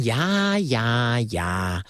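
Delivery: mocking yeses stepping down in pitch; mocking interruption. A narrator's reading. Niece interrupting her uncle's ultimatum, mocking. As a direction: cut in with bored, falling mockery. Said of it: "onderbrak Ardita hem spottend".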